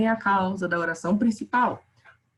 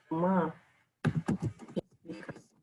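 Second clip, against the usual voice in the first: dramatic whisper; 5 semitones lower, 8 dB quieter, faster than usual. Shown as a demonstration. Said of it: "Quase uma vírgula dramática".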